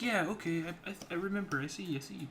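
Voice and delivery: put-on high girly voice. Sister, very high.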